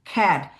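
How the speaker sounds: খ্যাট with an aspirated খ at the start; phonetic cat. Shown as a demonstration. Teacher gives the sound of cat as খ্যাট. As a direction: say খ্যাট as the sound, not the letter name. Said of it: "কিন্তু sound হচ্ছে কি?".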